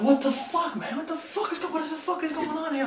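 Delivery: very high, hysterical squeal; freaking out. Retold or imitated. Freed victim demanding answers, freaking out. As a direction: re-enact a panicked, squeaky meltdown. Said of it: "Like this, I'm freaking out".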